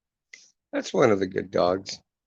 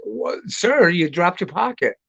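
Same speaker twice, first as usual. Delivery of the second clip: higher, bright fake-concerned call; son's mock helpful call; 7 semitones higher, 5 dB louder than usual. Son pranking passersby, higher mock-helpful call. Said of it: "he goes".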